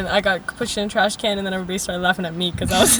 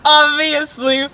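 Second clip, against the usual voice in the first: gushing agreement, higher and louder; delusional sister; 3 semitones higher, 4 dB louder, slower than usual. Acted. Playing the sister backing her up, hammed-up certainty. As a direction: drag out the obviously with total mock conviction. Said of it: "as delusional as me".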